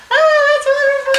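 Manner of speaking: very high, loud mock-delighted gush; hammed-up girlish coo of thanks; imitating her delight. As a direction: squeal it as her thrilled reaction. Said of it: "and she's like".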